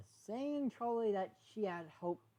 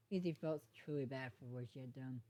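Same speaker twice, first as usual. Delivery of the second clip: ordinary delivery, nothing marked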